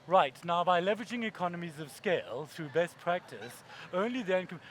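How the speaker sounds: abrupt drop into corporate monotone; droning executive. Retold or imitated. CEO starts the half-hour presentation, dull drone. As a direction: drone the jargon after all the hype.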